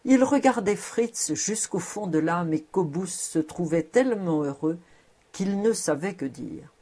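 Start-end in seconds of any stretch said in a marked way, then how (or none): none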